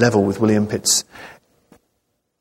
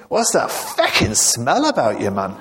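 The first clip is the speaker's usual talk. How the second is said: put-on comic Scottish accent, pitched up. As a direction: demand it in a put-on Scottish brogue. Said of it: "So I resorted to Scottish there".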